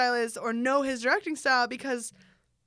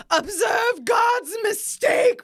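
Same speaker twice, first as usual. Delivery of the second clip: slow, drawn-out shout, much higher and louder; quoted movie shout; 11 semitones higher, 9 dB louder, much slower than usual. Retold or imitated. Quoting a favorite movie line to her cohost, slow shout. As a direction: bellow it like a grand movie villain. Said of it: "My favorite line is still the".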